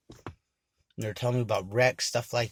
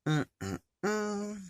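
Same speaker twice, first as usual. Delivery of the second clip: eerie humming voice; 11 semitones higher, 4 dB quieter than usual. Retold or imitated. The mysterious voice returns.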